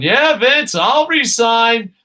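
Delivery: loud, high defiant yell at the boss; mock shouted resignation. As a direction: shout it like quitting on the spot. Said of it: "wrestlers going".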